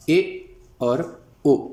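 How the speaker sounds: very slow ए और ओ, each vowel stretched; Urdu vowels. Shown as a demonstration. Teacher slowly modelling the Urdu vowels ए and ओ. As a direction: draw out ए and ओ as Urdu sounds. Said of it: "Urdu में इनकी आवाज़ें".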